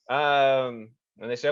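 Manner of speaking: usual speaking voice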